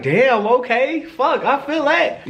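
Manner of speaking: high, slow, drawn out; impressed surprise. Retold or imitated. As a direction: savor it, impressed. Reacting to her being open.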